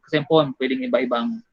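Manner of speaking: normal talking voice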